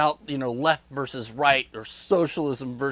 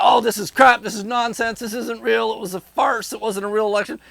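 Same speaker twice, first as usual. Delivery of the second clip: high, loud indignant rant; hammed-up fury over a farce election; mock outraged voter; 6 semitones higher, 4 dB louder than usual. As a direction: play the fuming sore loser. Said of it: "and you're just like".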